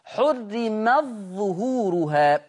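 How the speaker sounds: "حرمت ظهورها" بإدغام التاء في الظاء; تُنطق "حرمت ظهورها" بظاء مشددة بلا تاء; حرمت ظهورها بالإدغام. Shown as a demonstration. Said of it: "الظاء". (تلاوة بطيئة لـ"حرمت ظهورها" مدغمة)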